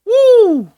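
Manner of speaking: high, loud whoop; a big celebratory holler; cheering woo. Real, not acted. Cheering a good stat.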